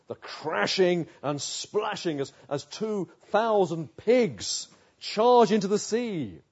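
vivid, rising preacher's crescendo, stretched at the end; dramatic build. Real, not acted. Preacher picturing the pigs' stampede for listeners, dramatic build.

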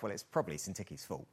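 private thought. Pretend. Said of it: "thinks to himself".

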